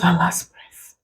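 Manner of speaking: breathy hushed whisper; barely voicing the words in grief. Real, not acted. Mother recalling her baby's death, whispered.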